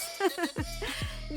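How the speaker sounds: short, soft laugh; light chuckle. Real, not acted. Narrator amused by the owner's talkative nickname, soft chuckle. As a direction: chuckle lightly at your own quip.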